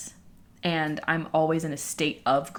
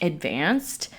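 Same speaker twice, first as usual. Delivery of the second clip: ordinary talk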